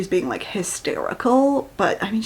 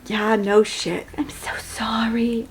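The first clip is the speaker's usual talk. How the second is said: mock sorry whisper; 3 dB quieter, slower than usual. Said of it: "I'm like".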